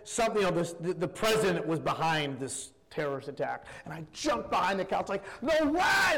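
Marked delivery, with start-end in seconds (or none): 5.4-6.2 high, loud shout of disbelief; startled shout. Retold or imitated. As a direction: yell it at the TV as the twist lands. Jumping behind couch at TV.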